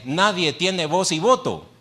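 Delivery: ligera imitación de quien manda, más agudo; autoridad imitada. Acted. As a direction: imita una orden tajante al público, algo más fuerte. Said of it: "es como quien dice". (imitando una orden autoritaria)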